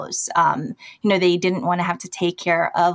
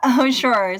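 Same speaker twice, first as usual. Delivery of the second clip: ordinary talk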